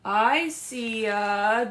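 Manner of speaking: loud, very slow storybook reading; read-aloud. Acted. Librarian reading Brown Bear to young listeners, loud slow storybook voice. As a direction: stretch every word out for the kids. Librarian pauses before the answer.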